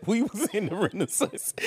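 laughing through the words; can't hold back the laugh; real laughter at his own mistake. Real, not acted. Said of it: "laughing at own mistake".

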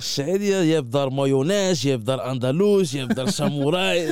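high, loud, slow listing voice; Moroccan accent. Retold or imitated. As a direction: proudly list sauces in his accent. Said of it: "Hij praat zo".

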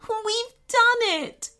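slow, high cry of joy through happy tears; woman weeping happily as the door opens; joyful cry. Acted. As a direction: cry out in relief. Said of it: "cried the woman".

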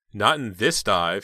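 slow, loud hoarse quip; hoarse, funny voice. A narrator's reading. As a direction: drag it out in a funny, husky voice. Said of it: "she said in her hoarse, funny voice".

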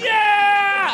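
high, loud crowd roar; crowd cheer. Retold or imitated. Crowd answering the comic, loud cheer. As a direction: yell back like a whole arena.

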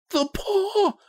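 very high, wild outcry; wild cry. A narrator's reading. Wife suddenly remembers the paw at night, wild cry. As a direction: cry out wildly as if seized by an idea. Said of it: "she cried wildly".

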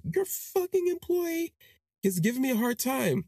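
mock the angry customer complaining about staff; furious customer. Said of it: "And he is pissed".

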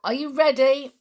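high, loud call; crying out. A narrator's reading. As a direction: call out to the crew. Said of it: "cried Julian".

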